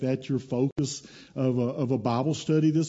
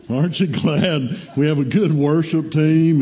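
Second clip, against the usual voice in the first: louder, higher, emphatic question; asking again with gusto; 4 semitones higher, 8 dB louder than usual. Shown as a demonstration. Preacher re-asking the congregation to get a response, loud and rousing. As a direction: rouse the crowd with a loud question. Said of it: "let me ask that again".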